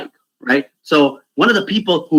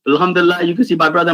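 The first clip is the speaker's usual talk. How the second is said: normal talking voice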